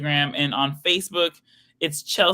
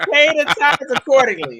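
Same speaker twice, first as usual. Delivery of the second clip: mock preaching; 9 semitones higher, 7 dB louder than usual. Mock preacher demanding tithes.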